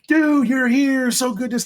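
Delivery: overly friendly greeting. Retold or imitated. Imitating a nudist host welcoming newcomers, high and gushing. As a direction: greet him like a long-lost buddy.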